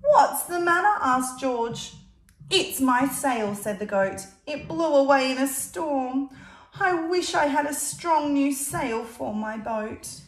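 put-on lively read-aloud to a child; storybook reading voice; story narration. A narrator's reading. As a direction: tell it like a picture book. Reading picture book aloud.

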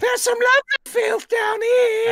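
shrieking high peasant-woman falsetto; high, shouted comic British squawk; Terry Jones. Retold or imitated. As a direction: screech it loud in a Python drag voice. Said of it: "Terry Jones from, from, uh, Holy Grail".

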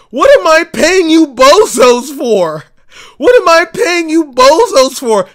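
loud, high, furious shout; ranting at insurers, shouting in disbelief; angry yell. Real, not acted.

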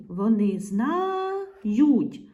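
very slow "знають", long held first vowel; drawn-out sounding out. Shown as a demonstration. Teacher finding the ending with the pronoun, syllables drawn out. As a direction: stretch ЗНАЮТЬ so the ending stands apart.

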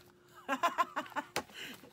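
loud high laugh at the gross gift; burst of laughter. Real, not acted. Cracking up at chocolate covered worms, loud laughter.

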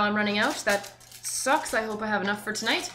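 ordinary talk, nothing marked

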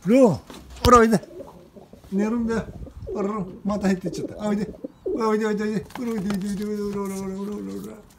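high, sing-song call to a pet bird; coaxing a pet. Real, not acted. Man coaxing his pigeon Ron to come, high pet-talk call. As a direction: lure the bird back gently but up high.

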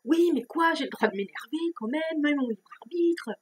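mock-indignant comeback, pushing back hard; indignant retort. Retorting to being scolded.

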